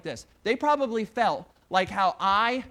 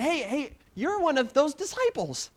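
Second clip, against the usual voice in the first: accusing servant woman; 6 semitones higher than usual. Acted. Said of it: "one of those ladies that came by and said".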